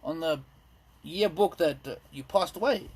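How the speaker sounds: normal talking voice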